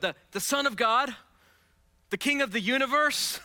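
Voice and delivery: high, loud, ringing preacher's voice; preaching at full force. Acted. Pastor extolling Jesus to the congregation, preaching loud. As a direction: build each title of Christ.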